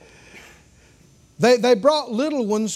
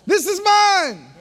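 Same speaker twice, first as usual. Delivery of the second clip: put-on high, slow, delighted child's claim; child hugging gift; 7 semitones higher, 3 dB louder, slower than usual. Preacher voicing a child hugging a present, high and delighted. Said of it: "they might hug it and go".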